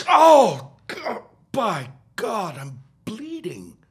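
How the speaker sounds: high anguished cry, fading to a broken moan; cry of pain. Acted. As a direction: howl in pain then sink to a groan. Struck by mallet, in pain.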